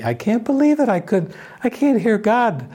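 put-on high, plaintive small child's voice; childlike plea. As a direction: plead like a bewildered little child. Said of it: "like a little child".

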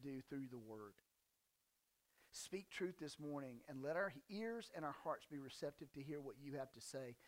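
low, soft, reverent praying voice; prayer. Real, not acted. Preacher asking the Father to speak truth this morning, prayerful.

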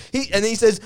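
ordinary talk

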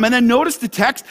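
normal talking voice

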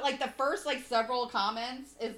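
usual speaking voice

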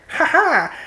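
high mock "ha ha"; imitated laugh. Guessing a drawn character's look, imitated high laugh. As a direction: put on a high-pitched cartoon laugh. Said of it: "she looks like".

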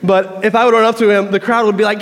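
usual speaking voice

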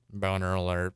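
deadpan mechanical alert, repeated; robot voice. Shown as a demonstration. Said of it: "Robot voice".

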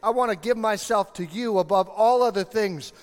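high, loud, pleading prayer, slowing on all in; earnest imagined prayer voiced loud and high; fervent prayer. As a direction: pour out the prayer with longing.

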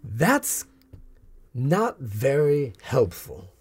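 put-on theatrical villainess shooing children off; slow disapproval, then airy shooing; would-be stepmother, dismissive. Villainess shoos children away after attack on mercenary, dismissively.

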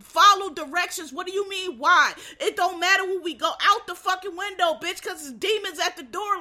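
high, forceful fed-up outburst; exasperated scolding. Acted. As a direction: scold someone who keeps asking questions. Arguing with slow-witted show character.

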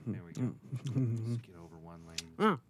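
ordinary delivery

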